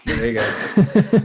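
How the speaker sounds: slow words through a laugh. Real, not acted. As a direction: laugh it out slowly. Laughs at joke about hit.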